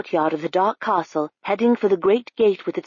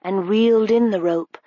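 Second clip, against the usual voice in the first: plain reading voice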